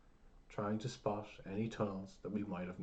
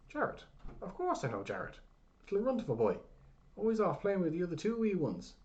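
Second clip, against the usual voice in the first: put-on old man; 10 semitones higher, 6 dB louder than usual. A narrator's reading. Old man voiced high, loud.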